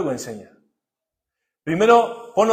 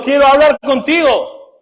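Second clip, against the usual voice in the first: higher, louder and slow, a longing plea; God pleading; 9 semitones higher, 8 dB louder, slower than usual. Retold or imitated. God asking a believer to talk with Him, slow loud plea. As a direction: stretch it out like an urgent invitation. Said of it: "Dios te está diciendo".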